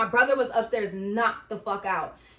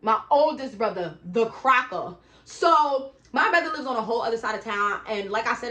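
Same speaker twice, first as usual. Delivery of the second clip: ordinary delivery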